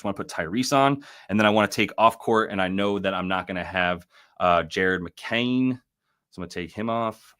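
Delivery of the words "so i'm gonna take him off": slight, offhand Australian accent; a touch of Australian accent. Real, not acted. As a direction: slip briefly into an Australian twang. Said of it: "I gave him an Australian accent".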